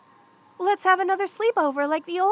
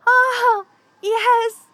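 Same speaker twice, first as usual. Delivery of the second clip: loud, high cry of joy; elated relief; 8 semitones higher, 10 dB louder than usual. Acted. Snake girl as the power returns, elated cheer. Power comes back in blizzard.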